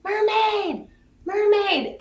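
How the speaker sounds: high, sing-out mock call; retold calling out. Grandmother retelling her call to her grandson, playful high calling. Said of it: "Well, help me call".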